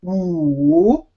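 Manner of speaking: "wu" drawn out, dipping low then rising; slow, lengthened vowel. Shown as a demonstration. Teacher showing the third tone, "wu" slow and long. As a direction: stretch "wu" to trace the whole curve. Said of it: "say it very slowly and longer".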